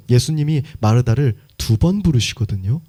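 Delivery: ordinary talk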